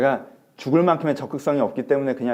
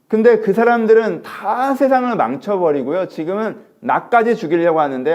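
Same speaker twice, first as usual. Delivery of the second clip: high, loud protest; impassioned complaint; 8 semitones higher, 9 dB louder than usual. Acted. Elijah protests loudly to God, high and aggrieved. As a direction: pour out the grievance.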